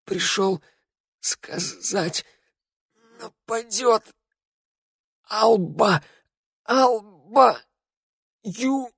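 broken, gasping words with long pauses; acted death rattle, high and strained; dying rasp. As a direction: squeeze out each word while dying. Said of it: "прерывистый хрип Раду".

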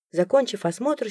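plain reading voice

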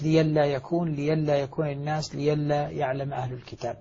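ليلا with a ya, li-yal-la, no hamza; Warsh reading. Shown as a demonstration. Teacher reciting ليلا in the Warsh reading for his students. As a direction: demonstrate ليلا as Warsh reads it, hamza turned to ya. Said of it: "ليلا في المواضع الثلاث".

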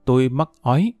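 usual speaking voice

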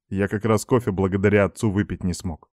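plain reading voice

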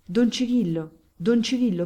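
two urgent calls of the priest's name; calling out. A narrator's reading. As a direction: call his name to stop him. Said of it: "quando sentì chiamare".

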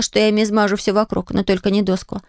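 plain reading voice

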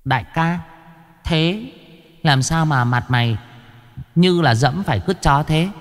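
put-on high, teasing boy's voice; mock the big boss playfully; little ghost teasing Chí Béo; cheeky ghost boy.

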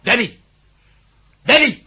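loud, high shout rising in alarm; calling a missing man. Acted. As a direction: yell his name into the dark.

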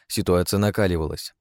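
ordinary delivery, nothing marked

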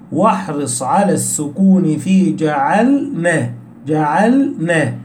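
slow, "جعلنا" with ل held apart from ن; clear still lam. Shown as a demonstration. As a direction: sound the vowelless ل fully in "جعلنا". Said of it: "إتقان حال بيان الحكم". ("جعلنا" ببطء، ببيان سكون اللام)

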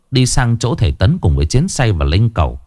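plain reading voice

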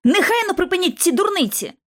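high-pitched scolding order; called-out command. A narrator's reading. Lucy scolds the boys about to fight, called-out command. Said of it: "Гукнула я досить голосно".

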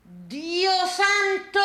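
high, very slow cry of dismay; appalled, climbing exclamation; drawn-out exclamation. Acted. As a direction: stretch the words out, pitched high.

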